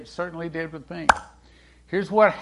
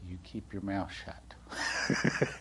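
normal talking voice

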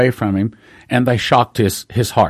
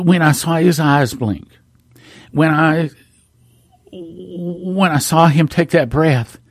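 real emotion, halting and higher-pitched; choked up; 4 semitones higher, slower than usual. Real, not acted. Witness recalling his friend's first breath, choked up. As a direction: struggle to get the words out, long pauses. Overcome while recalling the revival.

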